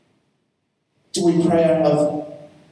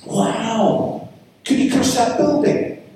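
lightly voiced curious child, pitched higher; child's wonder; 8 semitones higher, slower than usual. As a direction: ask it high, like an amazed little boy. Acting out his small son's questions.

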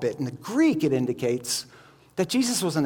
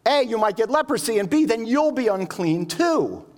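imagined Peter, higher and louder, urgently pleading; alarmed Peter; 6 semitones higher, 3 dB louder than usual. Retold or imitated. Preacher imagines Peter begging Jesus not to touch the leper, alarmed. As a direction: panicky don't-touch-him plea, climbing on the repeated don't. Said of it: "Peter must have been thinking".

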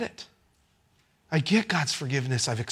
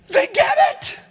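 high, loud shout of joy; excited shout; 3 dB louder than usual. Real, not acted. Pastor shouting to the congregation, thrilled the students got it, high excited shout.

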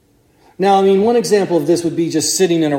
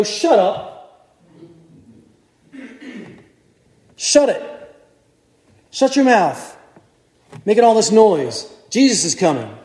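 higher, sharp mock hush-up; scolding crowd; 4 semitones higher than usual. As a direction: shush the noisy beggar with contempt.

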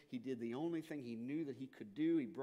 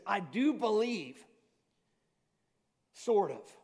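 desperate plea; 5 semitones higher, 8 dB louder, slower than usual. Retold or imitated. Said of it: "cried out with every bit of emotion".

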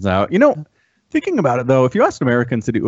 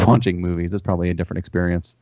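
ordinary talk, nothing marked

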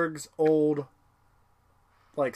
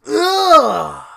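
much higher, loud grossed-out groan; disgusted ugh; 12 semitones higher, 11 dB louder than usual. Retold or imitated. Leela reacting to the jelly organ, loud disgusted ugh. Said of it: "Leela goes".

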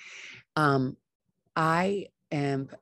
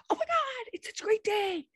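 very high squeal of mock joy; squealing high Oh my God great day; gushing delight. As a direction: gush like everything is wonderful. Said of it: "I'd be like".

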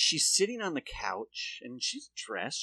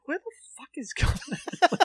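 mock baffled outburst; 10 semitones higher, faster than usual. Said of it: "And I'm like".

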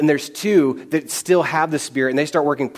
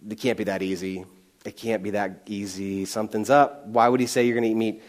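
much softer, low, wary muttering to yourself; hushed, suspicious aside; suspicious inner voice; 6 semitones lower, 6 dB quieter than usual. Retold or imitated. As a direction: think it aloud under your breath, doubtful. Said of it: "you're kind of like".

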